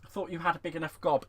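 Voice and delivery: ordinary delivery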